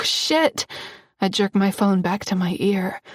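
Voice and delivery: usual speaking voice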